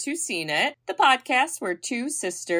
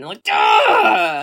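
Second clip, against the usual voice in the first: loud mock duh; emphatic shout; 3 semitones higher, 5 dB louder than usual. Real, not acted. Emphatic exclamation.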